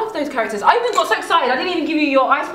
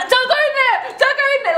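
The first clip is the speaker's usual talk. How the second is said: high, mock warning yell at the screen; playful cinema heckle; mock shout at the screen; 11 semitones higher than usual. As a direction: warn the film character, half-laughing. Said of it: "Like shouting in the cinema".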